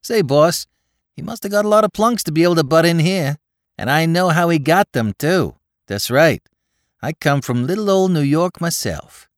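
put-on excited young New York tough; Bowery boy Spike. A narrator's reading. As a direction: rattle it off like a breathless Bowery lad. Spike voiced by narrator.

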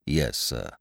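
a hint of a low, soft, deferential valet; lightly voiced butler, quiet and proper; Jeeves the butler. A narrator's reading. Butler answering his master, low and deferential.

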